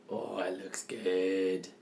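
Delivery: slow soft whisper; whispered awe. Real, not acted. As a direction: breathe it in awe. Hushed admiration of cake.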